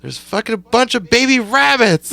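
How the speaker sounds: very high retold scream; imitated frantic wife. Wife runs out to stop husband on the tractor, imitated high frantic cry. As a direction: cry out in mock panic, pitched far up.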